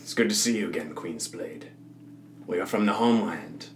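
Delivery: hushed, measured greeting from cloaked strangers; softly voiced hooded stranger; hooded men from the homeland. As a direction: speak low-key and soft, as a cloaked countryman. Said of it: "lower their hoods and say".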